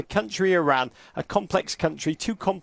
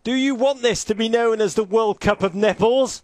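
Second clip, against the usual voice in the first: raised, challenging pep-talk voice; mock team talk; 4 semitones higher, 3 dB louder than usual. Coach rousing his team before kickoff, hammed-up speech. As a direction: demand it of your players. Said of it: "Here's what I'd say if I were Jurgen".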